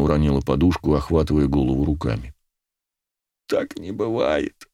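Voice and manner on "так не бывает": high, slow, thin whimper. A narrator's reading. Girl holding her head in shock, whimpering. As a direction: whine it slowly in disbelief. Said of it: "заскулила она".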